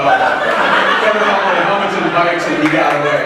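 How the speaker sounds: fast and loud, laughing through it; excited, laughing. Real, not acted. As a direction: race through it laughing, building up. Telling the wild chase.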